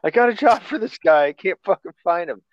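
high excited employer voice. Retold or imitated. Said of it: "Fucking be like".

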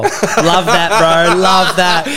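mock cheery, laughing; fake enthusiasm. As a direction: gush with fake energy. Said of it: "fake energy, fake smile".

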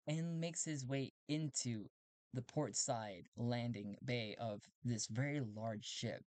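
ordinary delivery

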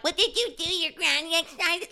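squeaky sarcastic mimicry; mocking parrot. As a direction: parrot the question in a silly high voice. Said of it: "I'm like".

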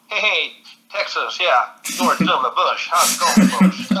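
slow, drawn-out Irish take on a Texas accent; Texan accent attempt. Shown as a demonstration. Irish guest shows how his American accent lands in Texas, exaggerated Texan drawl. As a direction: drag out a bad Texan drawl, George Bush style. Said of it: "It always comes out like from".

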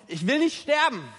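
a sudden desperate cry to God; desperate plea. Acted. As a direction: burst out, begging for life. Climax of the acted lament.

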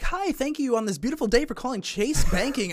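chirpy Chase call-center greeting; cheery bank rep. Retold or imitated. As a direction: overdo the scripted sunny welcome. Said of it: "they'll be like".

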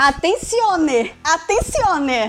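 slow mock Italian announcement; Italian accent calling attention; Italian accent portuguese. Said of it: "fazer o sotaque italiano".

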